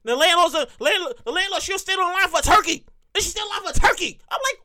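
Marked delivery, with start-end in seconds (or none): none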